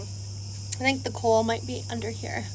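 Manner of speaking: ordinary delivery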